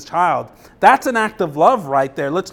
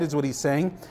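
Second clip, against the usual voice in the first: normal talking voice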